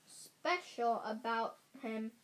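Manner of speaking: plain reading voice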